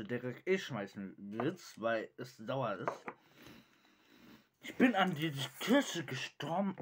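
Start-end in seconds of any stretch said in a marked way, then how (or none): 4.6-6.7 loud, pained cry from sourness; eating sour candy. Real, not acted. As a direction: howl it with a sour mouth. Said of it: "das ist so sauer".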